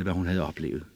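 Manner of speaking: plain reading voice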